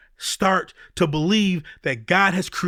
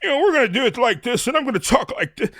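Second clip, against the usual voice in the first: higher and fast, mimicking a slick polished speaker; polished stage speaker; 7 semitones higher than usual. Mocking the slick seminar speaker he won't be, high and fast. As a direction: put on a showy conference-speaker voice, quick and high. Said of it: "So I could get up here and say".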